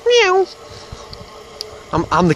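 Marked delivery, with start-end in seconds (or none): 0.1-0.5 very high mock meow; cat meow. Calling himself the cat spotter with a very high meow.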